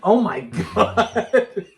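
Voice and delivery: slow, drawn-out disbelief through laughter; stretched-out mock amazement; mock astonishment. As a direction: play a stunned onlooker, laughing. Imagined fire watchers spotting costumed runners.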